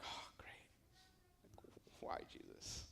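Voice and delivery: whispered aside, reluctant; dreading whisper. Acted. Peter singled out by Jesus, whispering in dread. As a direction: whisper it under your breath, dreading what comes.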